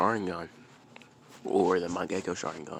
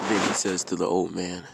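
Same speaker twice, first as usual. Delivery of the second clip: plain reading voice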